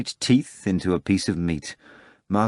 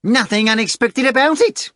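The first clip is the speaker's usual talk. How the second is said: raised, matter-of-fact henchman; a hint of a blunt henchman; Azazello's voice; 7 dB louder than usual. Azazello objects.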